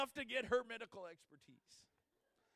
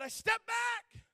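higher, louder, slow startled cry; startled shout; 12 semitones higher, 10 dB louder, slower than usual. Retold or imitated. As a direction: shout it as you jump back. Startled step back retold.

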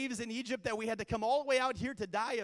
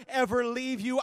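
ordinary talk

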